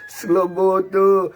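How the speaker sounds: drawn-out taunt. Acted. Mocking the rival with his nickname, slow loud jeer. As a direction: drag the insult out to mock him.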